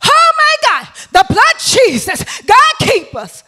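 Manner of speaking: high, loud retold panicked cries; panicked screaming. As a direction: scream it like terrified passengers on a dropping plane. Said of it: "They screaming".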